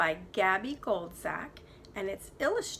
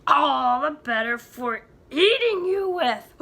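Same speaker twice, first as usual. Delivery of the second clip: louder, slow big bad wolf threat; menacing wolf; 5 semitones higher, 11 dB louder than usual. Wolf reveals himself.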